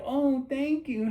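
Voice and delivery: high, slow, drawn-out simper; mock flattered woman. Woman thanking the man grabbing her hand, mock flattered. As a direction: imitate a woman melting at a compliment.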